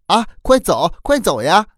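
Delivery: very high, excited cries; happy clamour. A narrator's reading. Children hearing mother lives nearby, excited happy shouting. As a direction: cry out high and eager to get going. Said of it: "高兴地嚷着".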